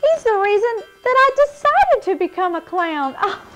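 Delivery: high, breathless delight; excited. Acted. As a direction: squeal with happy surprise. Thrilled by news of Obo.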